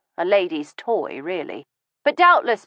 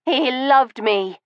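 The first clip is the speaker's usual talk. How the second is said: slow, insistent, clinging to it; insisting; 7 semitones higher, slower than usual. Acted. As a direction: insist he loved her, slowly. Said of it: "she insisted".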